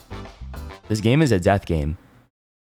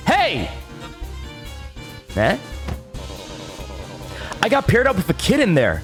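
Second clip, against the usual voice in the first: angry; 3 semitones higher than usual. Acted. Contestant confronts the host.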